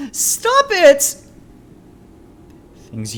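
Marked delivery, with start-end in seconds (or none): 0.1-1.2 desperate scream. Acted. Child screaming at father to stop, terrified. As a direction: gasp then a high screaming stop it.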